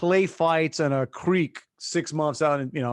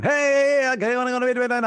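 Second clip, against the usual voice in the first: higher, announcing to an empty church; mock auctioneer; 8 semitones higher than usual. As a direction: play the kid hamming it up at the mic.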